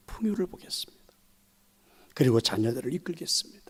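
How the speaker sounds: hushed, whispered resolve. Real, not acted. Preacher whispering his resolve to the church. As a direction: drop to a whisper for emphasis.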